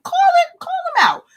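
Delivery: high-pitched excited holler; calling out. Real, not acted. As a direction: holler it out high to rally viewers. Urges viewers to call out friends.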